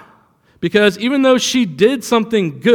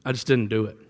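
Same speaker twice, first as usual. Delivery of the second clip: low, soft, halting mock admission; lightly voiced slacker with no excuse; excuseless employee; 9 semitones lower, 10 dB quieter, faster than usual. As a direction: stumble quietly through a feeble answer. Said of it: "he just didn't have an excuse".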